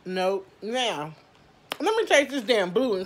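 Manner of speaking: ordinary delivery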